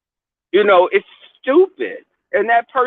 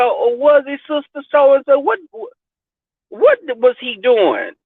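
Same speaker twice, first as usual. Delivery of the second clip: higher mock-concerned grandmother, slowing at the end; grandmother questioning; 6 semitones higher than usual. Grandmother questioning the church mother, mock concern. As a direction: play a grandma hearing bad news, pitched up. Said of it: "And she said".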